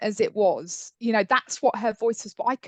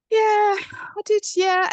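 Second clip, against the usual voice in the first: much higher, slow, drawn-out yeah; mock sing-song agreement; 12 semitones higher, 6 dB louder, slower than usual. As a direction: humour the child with a sing-song yes. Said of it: "And I go".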